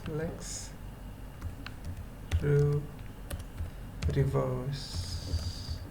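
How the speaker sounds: ordinary talk, nothing marked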